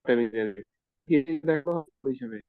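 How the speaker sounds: ordinary talk, nothing marked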